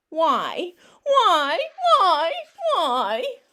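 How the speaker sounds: frustrated pigeon outburst. Acted. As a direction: throw the whys out like a tantrum.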